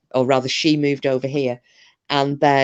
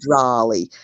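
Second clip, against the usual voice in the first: cowboy drawl; 5 semitones lower than usual. Shown as a demonstration. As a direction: lower American cowboy "drawly", vowel opened to "drah-lee". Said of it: "what I would imagine some cowboys having".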